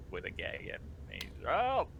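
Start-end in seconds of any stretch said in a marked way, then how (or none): 1.5-1.9 put-on old dwarf calling out. Gagged dwarf calls out.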